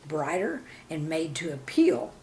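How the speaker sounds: usual speaking voice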